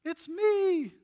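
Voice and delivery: a healed man waving his hand to be noticed; blind man insisting. Retold or imitated. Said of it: "the man's over there like".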